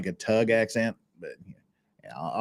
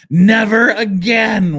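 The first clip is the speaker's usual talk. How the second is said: mock loud retort; 9 semitones higher, 10 dB louder, much slower than usual. Quoting the emailer's reply.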